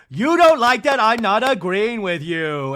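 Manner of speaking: high, loud mock outburst; hammed-up housewife yelling in protest; Lisa. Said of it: "And Lisa goes".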